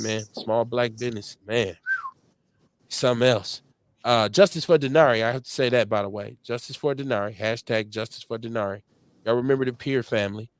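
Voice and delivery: ordinary delivery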